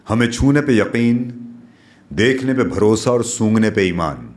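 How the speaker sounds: slowing on the closing lines of each verse; poetic recitation. Acted. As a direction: recite it like a poem to an audience.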